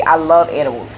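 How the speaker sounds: ordinary talk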